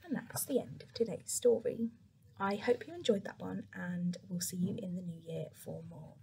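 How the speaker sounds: soft bedtime sign-off; gentle whispered outro; whispered goodbye. A narrator's reading. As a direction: whisper the farewell to sleepy children.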